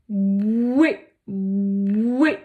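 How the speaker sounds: bouée drawn out with a rising tune, clearly articulated; articulé lentement. Shown as a demonstration. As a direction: sound out bouée slowly with the B. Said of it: "ou encore le mot". (bouée articulé avec une montée)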